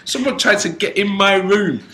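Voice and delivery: high-pitched, insistent argument; exasperated protest. Retold or imitated. Exasperated argument with hotel owner.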